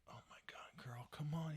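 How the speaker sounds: exasperated half-whisper; retold hushed parental impatience; frustrated muttering. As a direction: urge her on under your breath. Parent frustrated watching daughter's soccer.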